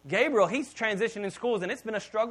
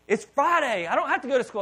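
put-on kid protest; 8 semitones higher, 5 dB louder than usual. Son protesting, retold.

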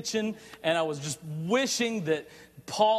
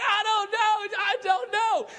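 flustered yell; 14 semitones higher, slower than usual. Retold or imitated. Husband answers wife amid flooded apartment, high frazzled yell. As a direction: throw up your hands and yell it. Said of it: "I'm like".

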